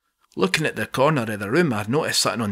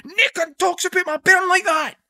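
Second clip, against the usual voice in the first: much higher, raging threat; furious scream. Acted. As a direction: scream it at the man who insulted your child. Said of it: "he's screaming".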